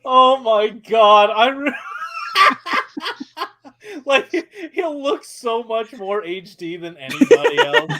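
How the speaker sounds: loud, high laughing fit. Real, not acted. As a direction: crack up mid-sentence. Cracking up at Chris Pratt idea.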